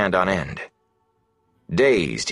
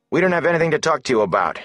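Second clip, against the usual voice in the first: high and fast, nervous defiance; tense refusal; 10 semitones higher, much faster than usual. A narrator's reading. Matt nervously refuses Goad through the door, high and quick. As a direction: blurt it out, scared but firm.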